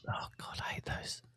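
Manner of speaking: breathy whispered groan of dislike; whispered dread. Real, not acted. Co-host reacting to team building, whispered. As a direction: whisper it like a shudder.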